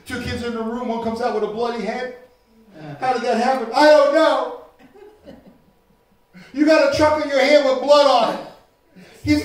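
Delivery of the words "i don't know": loud, high sulky denial; mock kid denial. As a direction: play the guilty kid denying it. Kid denies bloody fight.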